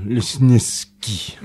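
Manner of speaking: "Lesnieski" dragged out very slowly, consonant by consonant; unpronounceable name. Shown as a demonstration. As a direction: struggle slowly through "Lesnieski" as a tongue-twister. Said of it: "avec un nom imprononçable".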